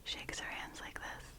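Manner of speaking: soft ASMR whisper; breathy close-mic whisper. Real, not acted. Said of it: "I was just doing some whispering".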